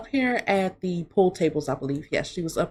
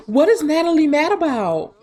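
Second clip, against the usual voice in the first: surprised gasp; 7 semitones higher, 5 dB louder than usual. Real, not acted. Gasps at upset sim.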